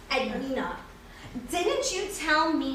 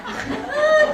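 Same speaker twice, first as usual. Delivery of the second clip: high burst of laughter; 11 semitones higher, 7 dB louder than usual. Acted. Laughing about old elevator.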